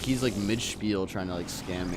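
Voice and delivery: normal talking voice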